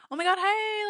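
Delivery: high, gushing hello; excited greeting. Retold or imitated. Waving to a schoolmate on the beach, delighted high greeting. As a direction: greet her like you're thrilled to see her. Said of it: "like, so happy".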